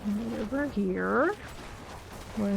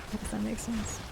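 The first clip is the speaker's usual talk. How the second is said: much softer, to yourself; muttered puzzlement; 9 dB quieter, faster than usual. Real, not acted. Streamer puzzling over chat's remark, quietly to self. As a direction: repeat it under your breath.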